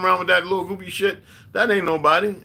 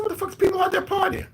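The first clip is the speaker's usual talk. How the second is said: very high, shouted protest; retold shout of disbelief to his girlfriend. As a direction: yell it in stunned disbelief.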